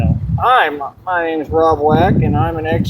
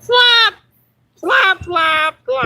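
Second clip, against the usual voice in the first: squeaky voice; 12 semitones higher, much slower than usual. Pretend. Showing off a silly voice, high squeal. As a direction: yelp it like a tiny bird. Pretend Batman flapping, squealing.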